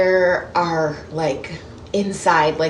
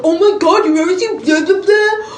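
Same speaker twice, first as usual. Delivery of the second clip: high, loud mock disbelief; mock outrage; 11 semitones higher, 5 dB louder than usual. Imitating people shocked she hasn't seen classic movies, high mock outrage. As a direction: exaggerate the pestering, trailing into loud blah-blahs. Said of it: "'cause people are like".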